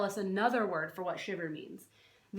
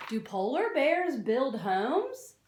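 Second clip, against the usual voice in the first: normal talking voice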